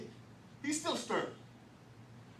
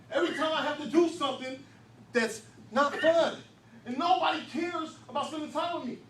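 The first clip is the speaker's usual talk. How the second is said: much higher, louder, building sermon; full-force preaching; 8 semitones higher, 11 dB louder than usual. Acted. Preacher thundering about a kid with no value at home. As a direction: preach it hard and rising.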